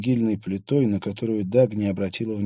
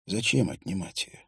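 ordinary delivery

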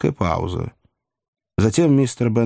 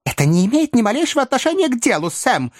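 high, quick, irritated interruption; interrupting; 12 semitones higher than usual. A narrator's reading. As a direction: cut in fast, voice pitched up. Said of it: "перебил мистер Пиквик".

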